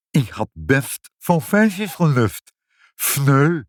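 high, slow lisp with "sausijsjes" mushy; lisping father. Acted. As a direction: grumble with "s" turned to "f", ending on "Fneu". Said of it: "je slist".

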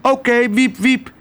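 high, chirpy bird cry; swallow calling out. A narrator's reading. Swallow accepts the chase from the starling, high calling. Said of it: "riep de zwaluw".

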